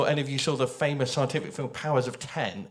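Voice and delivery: usual speaking voice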